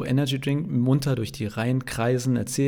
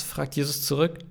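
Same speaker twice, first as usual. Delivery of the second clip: ordinary delivery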